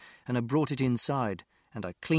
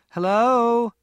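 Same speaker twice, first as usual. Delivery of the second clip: loud, high call into the house; mother calling into the empty house, loud and high; 10 semitones higher, 9 dB louder than usual. Acted. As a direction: call out to whoever might be home.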